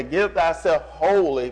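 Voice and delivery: "Give thyself wholly" said slowly, wholly held out; slow emphasis. Shown as a demonstration. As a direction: lay weight on wholly, unhurried. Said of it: "W H O L L Y".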